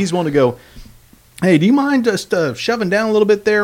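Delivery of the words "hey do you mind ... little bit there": lightly voiced, higher-pitched asker; imitated polite request. Moviegoer asking strangers to shove down, higher polite voice. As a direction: lift the pitch into a mock-polite favour.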